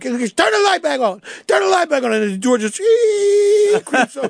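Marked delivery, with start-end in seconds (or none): 0.0-1.2 higher, frightened call in the dark; scared plea. Retold or imitated. Suspect in the dark room calling out, scared and higher. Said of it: "next thing you like". 1.5-2.1 panicked scream. Retold or imitated. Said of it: "next thing you like". 2.8-3.8 high, drawn-out creak sound; creaking door. Retold or imitated. Teller voicing the interrogation room door, high creak.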